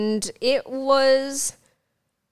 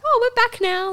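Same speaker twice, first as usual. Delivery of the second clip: imitated cheery greeting; 6 dB louder than usual. Voicing returning regulars' cheerful greeting.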